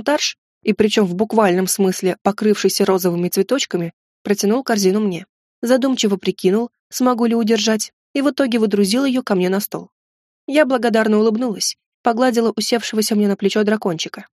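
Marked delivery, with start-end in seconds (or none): none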